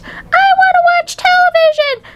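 put-on tiny boy throwing a tantrum; squeaked tantrum. A narrator's reading. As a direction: squeal it in a fit. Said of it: "he squeaked".

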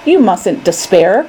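plain reading voice

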